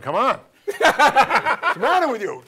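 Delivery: mock outburst from dad; exasperated father. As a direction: imitate a father losing patience. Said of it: "my father was going".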